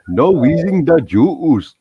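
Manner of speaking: slow, drawn-out movie catchphrase; Encino Man quote. Retold or imitated. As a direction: stretch it like the movie line. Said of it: "Encino Man".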